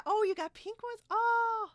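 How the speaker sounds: high, loud surprised "oh"; a sudden happy gasp at the pink clips; delighted surprise. Real, not acted. Friend reacts to seeing the pink clips, delighted surprise.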